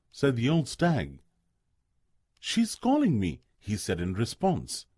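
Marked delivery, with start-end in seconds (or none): 2.4-3.4 put-on higher, eager young voice; pleading to be let go; eager Bambi. A narrator's reading. Bambi answering the old stag, eager and higher.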